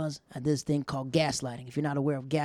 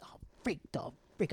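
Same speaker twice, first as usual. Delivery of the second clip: under his breath; 3 semitones higher, 5 dB quieter than usual. Retold or imitated.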